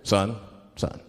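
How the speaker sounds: low, measured cop voice; mock-stern, low-pitched policeman; police officer. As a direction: address the driver in deep official tones. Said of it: "the police pull me over".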